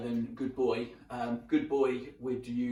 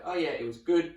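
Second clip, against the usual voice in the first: "good" lifted into a bright higher marker tone with a laugh; 5 semitones higher, 5 dB louder than usual. Shown as a demonstration. Chatting with his wife, joking marker tone. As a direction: answer his wife in dog-training voice. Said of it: "It wouldn't be like".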